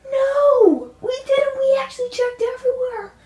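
high reporter voice. Acted.

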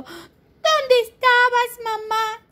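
put-on high baby voice. Baby asks mother.

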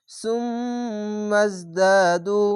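slow, long-drawn chanted syllables; tajweed recitation. Shown as a demonstration. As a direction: stretch every sound in recitation. Recites the joined words.

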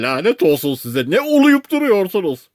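high, loud curse; angry shout. A narrator's reading. Castaway curses the crew holding his rope, angry shout. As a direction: yell in exasperation, climbing steeply in pitch.

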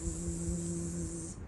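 soft, sustained buzz; bee buzzing. Pretend. Mother showing baby the bee, soft buzzing. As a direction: imitate a bee buzzing softly.

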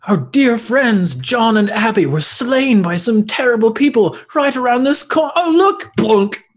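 mock goblin voice. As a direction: play a grieving goblin, hammed up. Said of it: "they'd actually be like".

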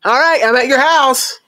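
high, singsong cheerful announcement; put-on chirpy voice, blithely ignoring her refusal; mock-eager creep; oblivious creep.

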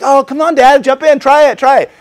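put-on high, loud excited kids' pleading; kids begging. Sons urging their dad to jump in, high and eager.

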